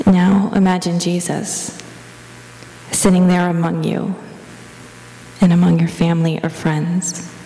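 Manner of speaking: even, level prayer-meditation voice with long pauses; guided meditation. Real, not acted. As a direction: guide them gently into the imagined scene, leaving space between phrases.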